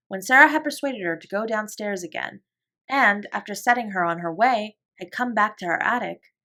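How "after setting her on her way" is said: usual speaking voice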